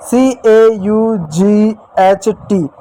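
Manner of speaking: each letter spelled out slowly and separately; spelling out letters slowly. Shown as a demonstration. Teacher slowly spells a word for children, letter by letter. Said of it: "जैसे".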